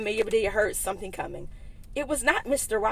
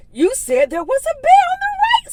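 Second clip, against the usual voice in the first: much higher, louder innocent voice; Amelia Bedelia; 10 dB louder than usual. Acted. Amelia Bedelia explaining the bear to Mister Rogers, high voice. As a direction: protest sweetly.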